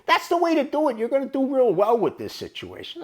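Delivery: high, loud hype; retold enthusiasm. Chuck applauds the clerk joining the bet, high excited encouragement.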